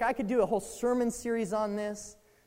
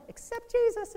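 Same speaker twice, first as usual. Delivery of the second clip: put-on lightly raised, childlike pitch; high child voice; 12 semitones higher than usual. Boy telling his dad on Mother's Day, lightly high child voice. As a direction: play his eight-year-old self, a touch higher. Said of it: "in my high voice".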